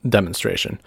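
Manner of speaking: ordinary delivery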